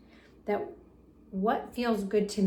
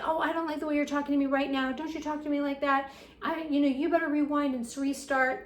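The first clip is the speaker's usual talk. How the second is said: fast, higher mock-scolding of a rude kid; scolding parent; 5 semitones higher, faster than usual. Shown as a demonstration. Parent scolding a rude kid, fast mock-angry. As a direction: snap at the kid like a stern parent. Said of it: "In fact, if you say".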